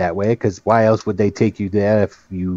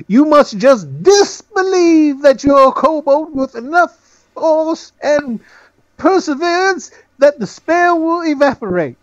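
gnome voice; 4 dB louder than usual. Acted. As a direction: lecture like a puffed-up little gnome. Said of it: "gnome puts his hands on his lapel".

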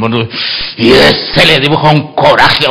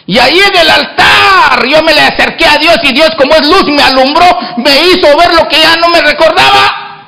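preaching; 9 semitones higher than usual. Acted. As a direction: lift the church with it. Preaching at full force. (predicando a todo pulmón)

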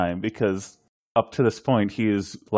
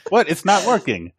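high, loud and laughing through the words; giggly Riker bit, pitched well up; laughing mock Riker; 9 semitones higher, 6 dB louder than usual. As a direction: ham it up while cracking up. Riker mocking a broken intercom.

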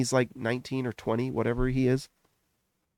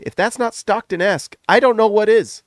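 excited shout; 7 semitones higher, 7 dB louder than usual. Real, not acted. Rising excited rant about Stockton.

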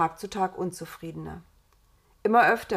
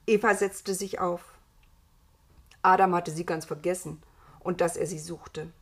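ordinary delivery

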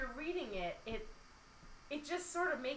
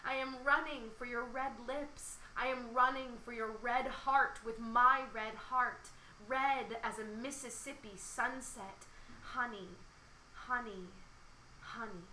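poem reading; 2 semitones higher than usual. Acted. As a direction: let the last words die away.

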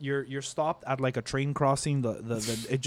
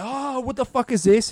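much higher startled cry of Oh, what the fuck is this; imitated shock; 9 semitones higher, 3 dB louder than usual. Driver imagines a shark landing on him, startled. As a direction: yelp it in panic. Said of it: "You're like".

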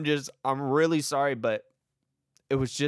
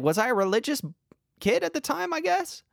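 unsure rising aside; 14 semitones higher than usual. Real, not acted.

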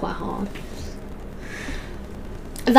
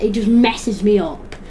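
ordinary delivery

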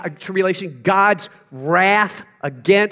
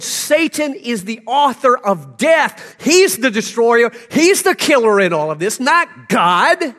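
loud, higher preacher's shout; full-force sermon shout; fiery preaching; 6 semitones higher, 6 dB louder than usual. Acted. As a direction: thunder it from the pulpit.

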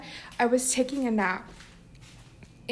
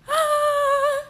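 high relieved cry; 5 dB louder than usual. Real, not acted. Relief at not whispering.

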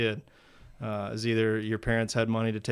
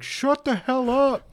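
much higher, slow mock put-down; annoyed crowd; slower than usual. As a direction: mimic the irritated crowd telling him to shut up. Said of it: "everybody at the park's like".

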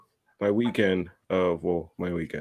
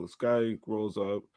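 ordinary talk, nothing marked

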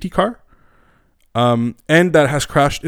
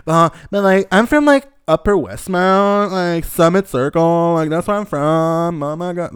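higher, louder, slow posh Upper Westmount drawl; snobby rich kid; 4 semitones higher, 4 dB louder, slower than usual. Retold or imitated. Mocking a rich Montrealer who brags about Upper Westmount, higher and slow. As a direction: ham up a spoiled kid bragging about his address. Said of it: "and then you're like".

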